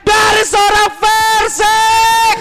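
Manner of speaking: fighting game announcer. Real, not acted. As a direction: scream the kill call. Casters call a triple kill.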